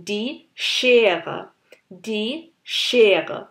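Schere with a strong sh; heavy sch. Shown as a demonstration. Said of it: "আরেকটু ভর দিয়ে". (Schere এর শ জোর দিয়ে)

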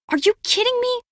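high-pitched disbelief; exasperated, incredulous question. Acted. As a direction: voice shoots up, can't believe it. Acted disbelief in an argument.

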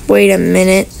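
ordinary delivery

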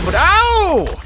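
high, loud yelp; startled cry. Real, not acted. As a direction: yelp as the blast hits. Blown up by creeper.

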